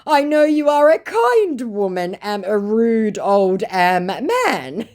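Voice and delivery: silly self-deprecating whine; grovelling scammer. Retold or imitated. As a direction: scammer calling himself a rude old man. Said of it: "To which he said".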